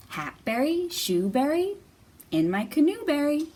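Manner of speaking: lively sing-song read-aloud; reading to children. Acted. Teacher reading Jamberry aloud to young kids. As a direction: bounce the rhymes for little listeners. Reads a picture book aloud.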